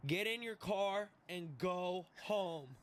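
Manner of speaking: slow, drawn-out PA command; police loudspeaker voice. Retold or imitated. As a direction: imitate a cop over the car speaker, word by word. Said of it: "get on the fucking speaker".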